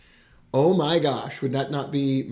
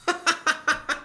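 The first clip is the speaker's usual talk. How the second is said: delighted laugh; 13 semitones higher than usual. Real, not acted. Delighted by viewer's text.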